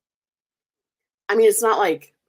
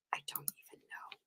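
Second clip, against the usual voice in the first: whispered; 26 dB quieter than usual. Real, not acted. Muttering confusion to herself.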